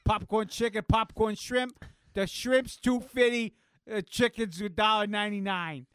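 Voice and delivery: a pushy food-counter clerk calling out what's on offer; clerk calling out prices. Retold or imitated. Mock clerk calls out prices to shoppers, loud and hammed-up.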